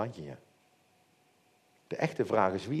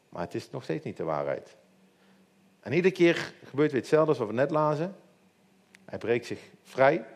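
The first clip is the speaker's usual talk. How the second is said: ordinary talk, nothing marked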